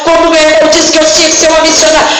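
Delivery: rising, rhythmic proclamation of miracles; full-force preaching. Real, not acted. Preacher declaring healings to the church, full-force preaching. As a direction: drive it home phrase after phrase.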